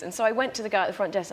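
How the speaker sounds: usual speaking voice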